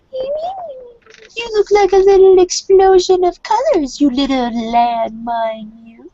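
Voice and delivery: put-on very high, drawn-out cooing baby talk; melting to mush. Kay melts over the little kitten, high slow gushing. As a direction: gush slowly over a tiny kitten, falling from a squeal. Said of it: "melts to this little mush".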